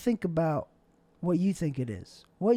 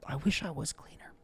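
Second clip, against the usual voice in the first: unvoiced I wish I was cleaner; soft whispered confession; 3 semitones lower, 10 dB quieter than usual. Real, not acted. Wistful aside, whispered.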